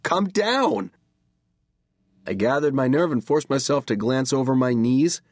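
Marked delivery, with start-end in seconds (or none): 0.0-0.9 high, insistent call; anxious plea. A narrator's reading. Brother urging the floating kid to descend, pleading. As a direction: urge it high, falling at the end.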